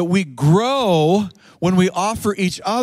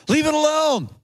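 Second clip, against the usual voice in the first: man in the parable; 10 semitones higher than usual. Acted. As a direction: plead the case in a raised pitch. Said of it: "the man replied".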